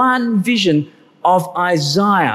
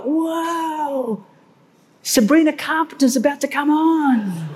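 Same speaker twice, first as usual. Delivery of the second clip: breathless, higher, awed fan gasp; concert excitement; 7 semitones higher, 3 dB quieter than usual. Pretend. Preacher imitating a fan waiting for the star, excited. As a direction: gush like a fan as the lights dim. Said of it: "You're kinda like".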